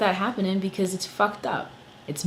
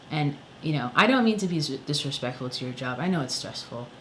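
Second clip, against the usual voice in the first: usual speaking voice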